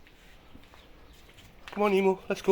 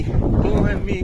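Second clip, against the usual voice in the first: louder, slow, high shriek; drawn-out shriek; 6 semitones higher, 11 dB louder, slower than usual. Real, not acted. Owner shrieks at the cat lashing out, loud and slow. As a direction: stretch it out in real alarm.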